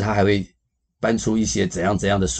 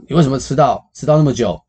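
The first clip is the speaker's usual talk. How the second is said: higher, louder mock scolding; annoyed girlfriend; 6 semitones higher, 6 dB louder than usual. Girlfriend scolding her late boyfriend, higher and louder. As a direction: demand why he came so late. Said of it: "就很不高兴".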